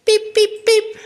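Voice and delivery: high, quick horn beeps; high toy-horn toots; little truck beeps. A narrator's reading. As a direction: beep like a small truck. Friends beep along with Blue.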